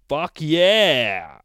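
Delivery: loud, high, drawn-out battle cry; triumphant scream. Acted. Mister Buttons leaps into the air, screaming loudly. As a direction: scream it out long as you leap. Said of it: "and screams".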